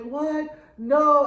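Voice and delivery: a high, loud cry of no; shocked disbelief. Retold or imitated. Louis reacting to Armand staying silent, shocked. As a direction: hit the no with sudden alarm.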